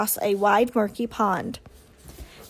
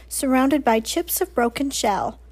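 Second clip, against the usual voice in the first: plain reading voice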